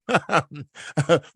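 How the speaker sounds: short real chuckle; amused laugh. Real, not acted. Astrologer amused by calling it shock therapy, short chuckle. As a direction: chuckle at your own phrase.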